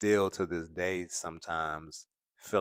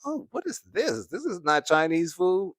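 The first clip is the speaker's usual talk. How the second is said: much higher, incredulous; mock dismay; 12 semitones higher, 4 dB louder than usual. New Yorker tasting Atlanta takeout, mock disgusted disbelief. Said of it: "I was like".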